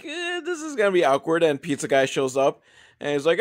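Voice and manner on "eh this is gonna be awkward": high, strained cringe; mock awkwardness. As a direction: imitate a group cringing at what's coming. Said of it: "they're all kind of waiting there like".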